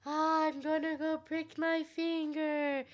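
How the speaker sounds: slow, soft mock trance; drawn-out, hypnotised pretend voice; entranced princess. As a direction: drift through it as if bewitched.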